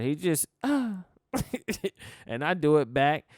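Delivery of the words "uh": exhausted straining uh; a weary, struggling groan; tired grunt. Retold or imitated. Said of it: "He just tired".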